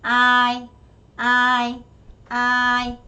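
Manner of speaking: 아이 with a long 아 and a short 이, level tone; repeated 아이, long first vowel. Shown as a demonstration. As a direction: hold 아, cut 이 short. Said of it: "아가 길고 이가 짧아요".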